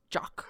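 "Jock" for Jack, teeth bared; Keira Knightley. Acted. Actress calling Jack Sparrow, "Jock" through bared teeth. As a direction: say "Jock" with lips apart, British vowel. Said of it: "Her lips never touch, and she bares her teeth".

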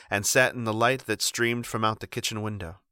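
plain reading voice

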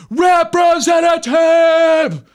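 high, slow, drawn-out shout of the word; stretched, furious yell for a human operator; yelling at phone menu. Retold or imitated. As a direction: bellow it at an automated phone system. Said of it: "you're just yelling".

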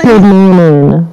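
muffled phone line; muffled bass only. Real, not acted. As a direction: caller on a dull, bassy line. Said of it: "[name], you sound muffled".

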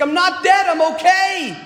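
high-pitched mock scream, urgent insistence; retold screaming. Dying man screaming at his wife who can't hear, retold high-pitched yell. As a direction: act out a desperate yell to be heard. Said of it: "I was screaming at her".

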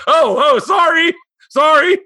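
high, loud panicked sorry; yelping sorry, sorry in alarm; flustered shouted apology. Retold or imitated. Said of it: "And I went".

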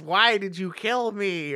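half-hearted ghostly wail of a question; mild mock ghost yell. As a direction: raise the pitch as a mock-yelling ghost. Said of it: "'Cause the ghost yells".

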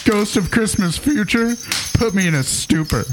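poem recital. Acted. Comic reading a Christmas poem to co-hosts, mock-solemn recital. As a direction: recite it like a sacred holiday verse.

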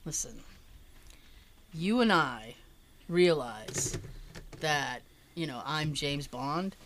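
low, hushed, measured confidence; confiding Roger Moore. Retold or imitated. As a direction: lean in and share a secret, pausing between phrases. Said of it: "he kinda leans in and he goes".

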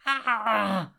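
high acted scream of pain; drawn-out, high-pitched scream. A narrator's reading. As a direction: cry out high as if hurt. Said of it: "He screamed and dropped the camera".

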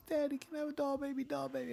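put-on high little girl voice, begging softly; daughter begging. Father voicing his toddler begging for a doll, high pleading. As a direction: mimic a toddler pleading for a toy, high and soft. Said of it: "and she's".

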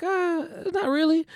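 high, airy brush-off; dismissive Aldo Gucci. Retold or imitated. Relative brushing off nephew's bootleg worry, high and airy. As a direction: wave the worry away lightly, imitating. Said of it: "It's like".

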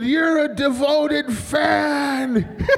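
very slow, high exclamation; awed fan. Retold or imitated. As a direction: stretch every word high in mock awe. Acting out awed tipsy fan.

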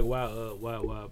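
usual speaking voice

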